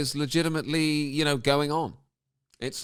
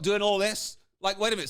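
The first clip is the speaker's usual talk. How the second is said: ordinary talk, nothing marked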